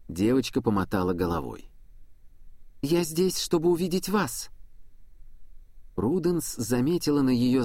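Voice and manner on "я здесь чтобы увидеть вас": lightly voiced young girl. Angel girl answers.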